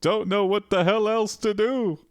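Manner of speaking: high-pitched creature voice confessing; a high, plaintive otherworldly being; ethereal Steve. Acted. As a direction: admit it helplessly in a high put-on voice. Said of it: "Steve's like".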